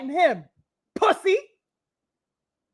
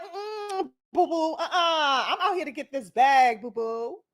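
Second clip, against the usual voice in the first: sassy mock voice; 3 semitones lower, 6 dB quieter, slower than usual. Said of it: "Imagine Foreman looked at Ali like".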